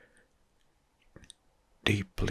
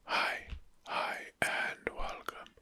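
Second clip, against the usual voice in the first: ASMR whisper. Acted. ASMR host whispering political facts to viewers. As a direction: breathe every word into the mic.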